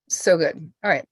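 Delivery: usual speaking voice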